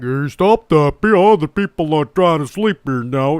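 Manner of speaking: lightly voiced, ineffectual dad; mock a dad feebly asking his kid to stop; spineless dad. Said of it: "what a fucking spineless dad".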